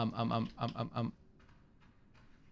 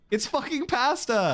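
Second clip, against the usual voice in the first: high-pitched indignant protest; exasperated; 7 semitones higher than usual. Real, not acted. Streamer correcting Google's pronunciation, exasperated. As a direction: argue back at the recording, indignant. Argues back at the recording.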